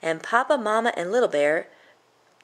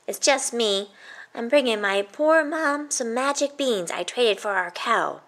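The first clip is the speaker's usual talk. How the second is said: put-on higher, boyish and polite; young boy's voice; 5 semitones higher than usual. Jack explaining his beans to the troll, boyish and higher. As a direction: answer like an innocent little kid.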